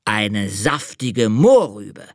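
slow, grand announcement; old hare proudly announcing his idea. Acted. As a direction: announce the carrot slowly, with pride. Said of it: "verkündete er".

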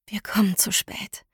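"Wir kommen zu spät" whispered, breathless and soft; whispered despair. Acted. Narrator's despairing thought.

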